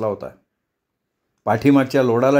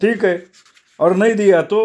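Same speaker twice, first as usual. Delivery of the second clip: high-pitched, defiant boss; defiant challenge; 7 semitones higher, 3 dB louder than usual. Acted. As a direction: dare him in a raised pitch. Boss defies demand for wages. (चढ्या आवाजात आव्हान)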